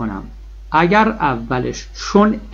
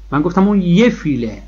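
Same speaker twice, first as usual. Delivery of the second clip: ordinary talk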